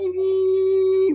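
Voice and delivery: a high, loud, muffled cartoon-adult squeal; Charlie Brown adult squeal. Imitating a spirit whose words can't be heard, high loud squeal. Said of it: "the Charlie Brown adults".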